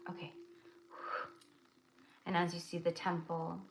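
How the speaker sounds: soft, steady meditation voice; guided meditation. Acted. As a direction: lead them gently into the vision.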